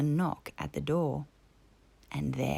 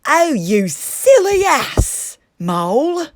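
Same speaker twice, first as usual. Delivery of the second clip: much higher, louder outburst; exasperated Toad; 8 semitones higher, 13 dB louder, slower than usual. A narrator's reading. Toad scolding Mole for spoiling everything, exasperated. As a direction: blow up at him. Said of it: "cried Toad".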